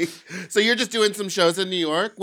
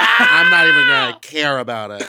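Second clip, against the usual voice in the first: shouted, much louder, defiant; 11 dB louder than usual. Real, not acted. Joking about future kids, shouted defiant. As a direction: yell it over the others' laughter.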